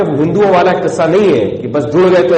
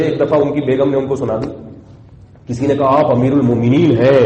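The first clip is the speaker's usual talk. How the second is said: ordinary talk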